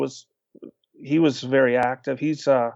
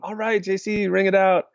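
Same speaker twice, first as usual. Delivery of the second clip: cheering on; 6 semitones higher than usual. Retold or imitated. Cheering friend on from ground.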